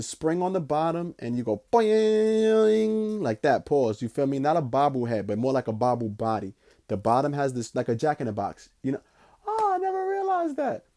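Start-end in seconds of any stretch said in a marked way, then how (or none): 1.2-3.2 much higher, slow, cartoon spring noise; springy boing. Shown as a demonstration. Imitating a spring. 9.5-10.8 squeaky aside. Retold or imitated. Aside in mock voice.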